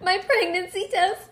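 high, slow put-on voice; hammed-up high prank caller. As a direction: deliver a fake confession, high and drawn out. Re-enacting a prank call line.

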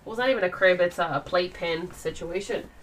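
usual speaking voice

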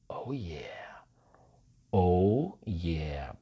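low, soft, breathy gloat; very satisfied. Acted. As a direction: savor it quietly with deep satisfaction. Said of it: "Porque está muy satisfecha". (satisfacción susurrada, grave y suave)

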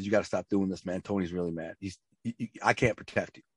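retold low-volume scolding, a hint of a stern grandfather; lightly voiced grandpa, pressing and hushed; hushed grandpa warning. Grandpa warns grandson in the basement, hushed stern voice.

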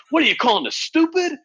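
high, loud mock-outraged challenge; indignant outburst. Employee confronting her manager at the meeting, indignant outburst. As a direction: act out a table-slamming protest, pitch shooting up. Acting out employee slamming the table.

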